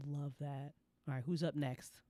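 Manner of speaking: ordinary talk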